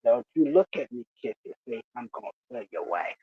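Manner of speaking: low, hushed, quick warning; teasing threat. Retold or imitated. Bernie threatens to tell the wife, low teasing undertone. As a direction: drop low and toss off a mock threat.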